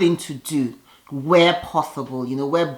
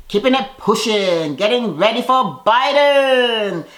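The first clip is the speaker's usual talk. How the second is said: drawn-out, louder, higher build on the name; announcer-style segue; 6 semitones higher, 4 dB louder, slower than usual. Pretend. Host moving on to the Biden segment, showy drawn-out announcement. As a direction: announce it big, stretching the last word.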